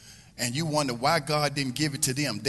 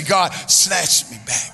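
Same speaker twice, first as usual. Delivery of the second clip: louder, slow and earnest "God, snatch me back"; meant it; 2 semitones higher, 11 dB louder, slower than usual. Shown as a demonstration. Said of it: "say it like you mean it".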